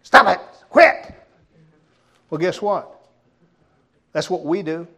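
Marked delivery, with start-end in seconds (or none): 0.0-1.1 disgusted dog owner. Pretend. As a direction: shoo it off in disgust. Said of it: "your dog gets sick and then goes".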